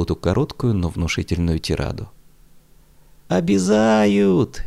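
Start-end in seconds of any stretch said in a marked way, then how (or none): 3.3-4.6 long high wailing cry; frantic wail. A narrator's reading. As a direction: howl like a hurt child. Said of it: "неистово завопил Лёша".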